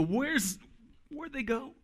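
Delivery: high, hushed panic; a parent losing a kid, looking around frantically; panicked parent. Retold or imitated. Parent who lost a kid at the mall, high hushed panic.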